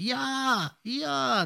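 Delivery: very high, stretched-out "Ja, ja"; imitated unhurried delivery. Showing how the veteran actor takes his time, drawn-out mimicry. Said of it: "wie sie sich Zeit lassen".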